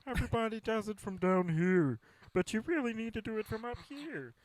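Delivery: mock Kermit coach. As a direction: lecture like a puppet voice coach, way up high. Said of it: "everyday impression of people now is gonna be at the Kermit voice".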